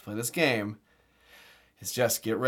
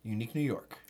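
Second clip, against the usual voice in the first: faster, softer Unique New York; quick quiet tongue twister; 4 semitones lower, 9 dB quieter than usual. Shown as a demonstration. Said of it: "a classic".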